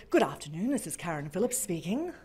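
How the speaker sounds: low, smooth radio-presenter greeting; best radio voice. Retold or imitated. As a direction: answer the phone in your lowest radio voice. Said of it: "Put on my best radio voice ever".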